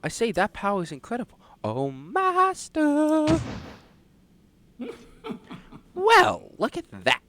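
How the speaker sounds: high, fussy nerdy voice; Discord mod voice. Acted. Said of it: "a Discord mod voice".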